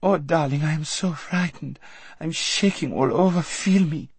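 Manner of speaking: put-on high, fussy girlish voice; a pampered girl clinging in fear; high, nervous society girl; frightened Lazareline. A narrator's reading.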